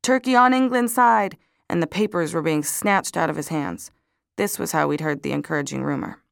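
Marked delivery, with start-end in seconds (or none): 0.0-1.4 high, loud street-seller's cry; newspaper vendor's shout. A narrator's reading. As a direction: call out the headline like a newspaper vendor. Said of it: "The newspaper vendor in Dam Square was shouting".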